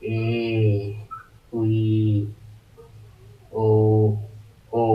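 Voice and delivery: drawn-out single vowels; teacher sounding ᱮ ᱩᱭ ᱳ; vowel sounds. Shown as a demonstration. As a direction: sound each vowel alone. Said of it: "ᱨᱤ".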